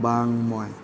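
বাংময় said as "bang-moy", drawn out; pronunciation demo. Shown as a demonstration. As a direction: hold the ng, then a clear m in বাংময়. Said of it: "বাংময় উচ্চারণটা কেমনে হবে". (বাংময়-কে টেনে "বাং-ময়" উচ্চারণ)

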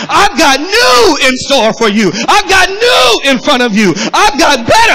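climbing pulpit crescendo in God's words; full-force preaching. Acted. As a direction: preach it with rising pitch, stretching key words.

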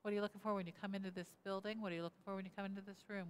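normal talking voice

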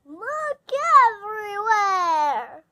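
loud, slow, high rallying call; eager call. Acted. Pine rallies Boof to search, loud and slow. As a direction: shout it out like a grand order. Pine calls out his plan.

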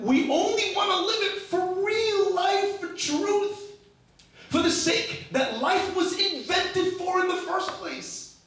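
very high-pitched, drawn-out preaching cry; impassioned plea. Real, not acted. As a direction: plead for real life at the top of your range.

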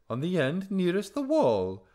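high, loud singsong bird; airy bird answering in singsong; Darzee. A narrator's reading. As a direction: lilt it out far up in pitch.

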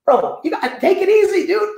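high-pitched, pleading mock protest; retold protest. Retold plea to calm down a friend.

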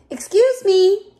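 high, slow, clearly projected polite request. A narrator's reading. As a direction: politely ask to get past, drawing it out. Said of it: "Little Bear said politely".

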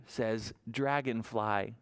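usual speaking voice

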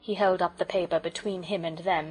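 usual speaking voice

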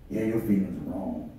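a hint of the doubting voice, pressing; inner voice of doubt. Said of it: "I'm preaching that war that goes on the inside".

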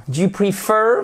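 prefer accentué sur -FER, montant; accent sur la seconde syllabe. Shown as a demonstration. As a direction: fais monter la fin de prefer. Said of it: "Là aussi". (prefer, seconde syllabe appuyée et montante)

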